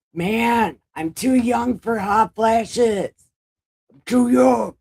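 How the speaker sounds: high, drawn-out wail of protest; slow, high-pitched griping shout; loud complaint. Real, not acted. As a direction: holler it in exaggerated protest. Complains about hot flashes.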